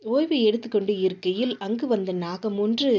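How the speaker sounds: plain reading voice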